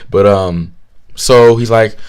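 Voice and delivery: ordinary talk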